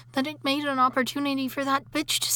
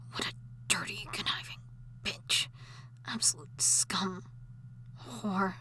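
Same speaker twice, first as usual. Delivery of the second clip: seething whisper; 5 semitones lower, 9 dB quieter than usual. Acted. Ghost wife whispering hatefully beside her sleeping husband. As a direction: hiss it under the breath, venomous. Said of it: "I'll wake him".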